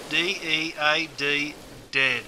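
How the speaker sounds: D E A D spelt letter by letter, slowly; spelled out slowly. Shown as a demonstration. Teacher spelling dead for a learner, slowly. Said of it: "then you're dead".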